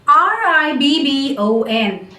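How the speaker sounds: normal talking voice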